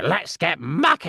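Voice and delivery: hint of the digger's voice; Muck voice. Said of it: "a character called Muck".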